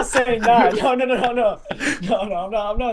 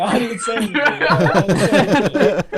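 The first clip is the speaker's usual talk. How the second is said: laughing protest, louder; laughing denial; 6 dB louder than usual. Real, not acted. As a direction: deny it through laughter.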